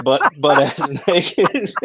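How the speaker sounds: laughing through the words, slow; can barely get it out for laughing; slow, laughing punchline. Real, not acted. Laughing while telling the story.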